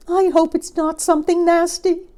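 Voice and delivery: very high, loud bird voice; singsong bird. A narrator's reading. Bird uneasy about the crocodile's plans, high singsong. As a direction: pipe it up high in a lilt. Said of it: "sang the Roly-Poly Bird".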